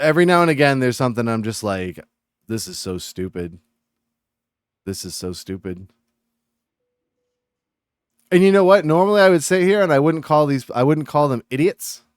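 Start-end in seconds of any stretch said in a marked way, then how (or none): 2.5-5.9 low, soft aside to oneself; quiet disbelief. Retold or imitated. Streamer reacting to stupid Reddit posts, quiet disbelief. 8.3-12.0 louder, higher, worked-up rant; agitated. Real, not acted. Streamer admitting they wouldn't normally call people idiots, agitated. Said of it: "I'm already coming into this agitated".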